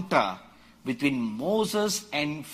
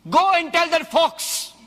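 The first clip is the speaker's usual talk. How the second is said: louder and higher, defiant retort; bold defiance; 11 semitones higher, 6 dB louder than usual. Retold or imitated. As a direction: Go and tell that fox, said high and loud. Jesus answers the warning boldly.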